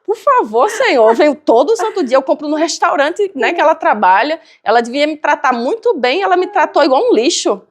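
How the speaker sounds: rising outrage, like she's been wronged; indignant complaint. Retold or imitated. Praying to the Lord about the rude cashier, indignant and high.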